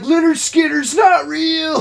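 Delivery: high, slow, shouted mock Mark; Mark impression. Friend mocking Mark with a high, slow shout. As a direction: yell it like a clueless Mark. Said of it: "Mark's gonna be like".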